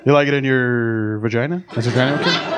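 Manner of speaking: unsure, slow, tentative dirty talk; a lightly voiced nervous lover trying dirty talk; hesitant dirty talk. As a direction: fumble the lines, asking permission. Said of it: "I had hesitation in my voice".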